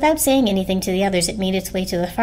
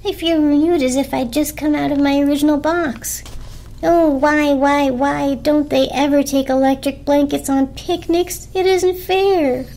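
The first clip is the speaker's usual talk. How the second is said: delighted blanket; 6 semitones higher, 3 dB louder than usual. A narrator's reading. Blanket praises the forest to the others, gushing. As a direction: swoon over the fresh air, then plead. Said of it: "the blanket declared".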